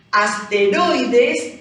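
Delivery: "Asteroides" very slowly, punching roi; drawn-out "Asteroides" showing the stressed roi; stressed middle syllable. Shown as a demonstration. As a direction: lean hard on the roi of "Asteroides". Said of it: "cargamos la voz".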